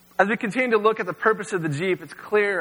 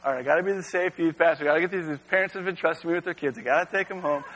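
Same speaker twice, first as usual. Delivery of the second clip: fast, self-talk; inner rationalising; faster than usual. Retold or imitated. Youth pastor reasoning with himself, quick inner voice. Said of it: "and I'm like".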